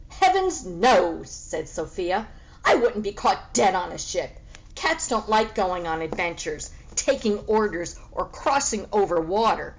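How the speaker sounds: loud, haughty "heavens, no"; scornful cat refusal. Acted. The cat, Sophia, refuses the pig's offer with horror. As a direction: recoil at the very idea.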